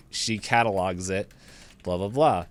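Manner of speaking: ordinary delivery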